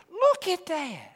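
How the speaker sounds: mock fearful believer staring at the enemy; panicked doubter. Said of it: "That's what you and I say".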